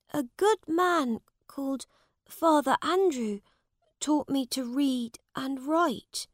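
a hint of young Tom; humble boy Tom. Tom answers the prince.

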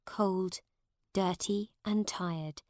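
ordinary delivery, nothing marked

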